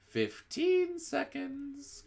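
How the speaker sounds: very high, drawn-out witch countdown; witch crying out. A narrator's reading. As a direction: stretch out the seconds gleefully. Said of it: "cried the Grand High Witch".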